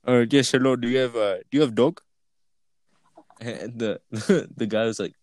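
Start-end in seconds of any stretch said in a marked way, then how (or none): 0.0-2.0 imitating a prank caller's Indian accent. Friend's prank call to a gas station, Indian accent. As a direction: put on a mock Indian accent. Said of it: "in an Indian accent".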